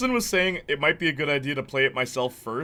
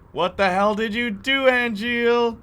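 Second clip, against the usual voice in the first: slow, higher-pitched incredulous question; questioning disbelief; 8 semitones higher, 4 dB louder, slower than usual. Retold or imitated. Imitating the original actor's line for chat, questioning in disbelief. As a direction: stretch it out, pitch climbing to Angeal. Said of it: "It's much more like questioning. Like, like, in disbelief".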